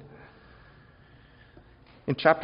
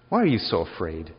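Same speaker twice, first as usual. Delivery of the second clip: louder, lower, unsympathetic; blunt rebuke; 4 semitones lower, 5 dB louder than usual. Shown as a demonstration. Jesus rebuking his scared disciples, blunt and louder. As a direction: throw the question at them with no comfort. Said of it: "He doesn't calm them down".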